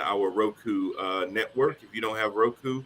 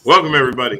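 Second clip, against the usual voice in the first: loud announcer welcome; 10 dB louder, much faster than usual. Real, not acted. Host opens show loudly.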